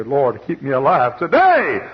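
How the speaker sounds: loud, slow earnest plea; pleading prayer. Retold or imitated. Widow praying to God for one day, loud plea.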